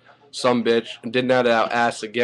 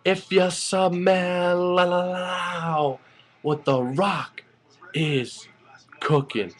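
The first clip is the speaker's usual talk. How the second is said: very slow and higher, stretching every word; The Rock catchphrase; 7 semitones higher, much slower than usual. Retold or imitated. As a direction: drag it out like The Rock closing a promo. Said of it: "And then he ends with".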